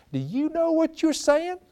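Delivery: high, slow disbelieving question; incredulous. Retold or imitated. Preacher voicing his inner disbelief at those prayers, high and slow. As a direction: stretch it out in disbelief.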